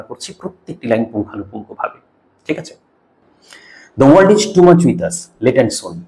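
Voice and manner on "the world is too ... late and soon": reads the opening line slowly and loud; poem recitation. Shown as a demonstration. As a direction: declaim the poem's first line. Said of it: "সবার প্রথমে যেটা প্রয়োজন". (ধীরে, জোরে কবিতার line আবৃত্তি)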